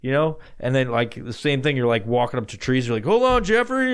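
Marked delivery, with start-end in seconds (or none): 3.1-3.9 high strained call; high, strained mock call; 1 urgent. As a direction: call out while scraping antlers. Pretending to scrape antlers.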